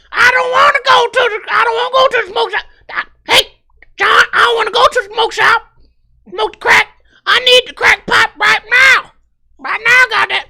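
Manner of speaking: high, loud whining woman; whiny screeching girlfriend. Acted. Girlfriend whining at John for a crack pipe, high and shrill. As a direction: wail it like a spoiled addict.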